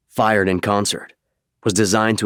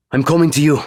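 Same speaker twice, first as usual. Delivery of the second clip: higher and louder pilot calling over the radio, with a relieved sigh in the middle; pilot on comms; 7 semitones higher, 5 dB louder, faster than usual. Acted. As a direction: call out over the comm, relieved to find him.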